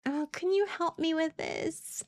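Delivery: high, slow, helpless sweet Southern belle; breathy-free, slow faux-helpless damsel plea; Southern Stupid. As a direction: play dumb and helpless, sugary high. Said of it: "I played Southern Stupid".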